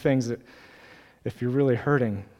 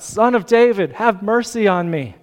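high, loud retold shout; 7 semitones higher, 8 dB louder than usual. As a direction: shout a plea over a crowd. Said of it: "shouts out".

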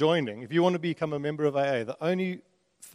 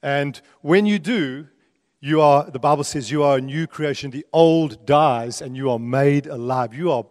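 louder, punchy scripture preaching; emphatic preaching; 6 dB louder than usual. Real, not acted. Preacher declares new creation in Christ to congregation, emphatic preaching. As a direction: proclaim it to the room with weight on key words.

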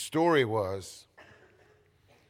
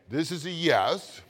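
nodding yes cue; 5 semitones higher, 5 dB louder, slower than usual. Shown as a demonstration. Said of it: "I'll help you again".

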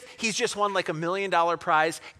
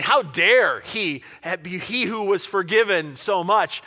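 loud, slow, emphatic outrage; indignant; 8 dB louder, slower than usual. Acted. Listeners outraged at the forgiven servant, indignant. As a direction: lean hard on each word, scandalized.

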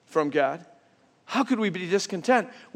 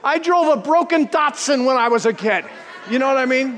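mock outrage; 5 semitones higher than usual. Real, not acted. Pastor telling the congregation about his Datsun, mock-outraged shout. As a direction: protest with a shout, half joking.

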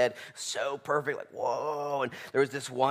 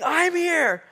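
climbing higher and louder with each line; amazed shouting; 16 dB louder, much slower than usual. Acted. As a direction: shriek of disbelief.

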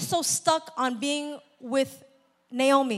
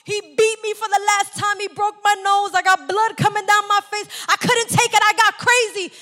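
crying; 6 semitones higher, 4 dB louder than usual. Acted. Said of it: "and she's crying".

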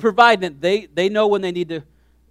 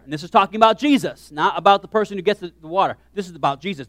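usual speaking voice

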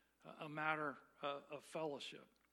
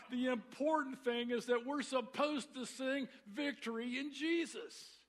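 high, emphatic outraged yell; irate shout; 6 semitones higher, 4 dB louder than usual. Retold or imitated. Father overrules his daughter, irate emphatic shouting. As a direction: shout it like a father who won't be reasoned with. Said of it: "he shouted, using profanity for emphasis".

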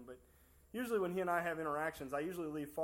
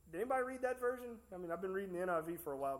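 ordinary talk

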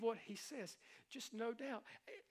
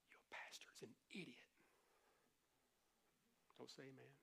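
a taunting enemy whispering low; devil's whisper; 9 semitones lower, 22 dB quieter than usual. Acted. Pastor voices the enemy in a low taunting whisper. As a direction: hiss the insult like the tempter.

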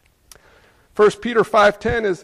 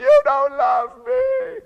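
put-on much higher, slow, drawn-out child's whine; whiny child voice; much slower than usual. Father imitating his crying toddler at the doctor, high whine. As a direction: whine it like a small kid dragged to the doctor. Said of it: "my kids would scream".

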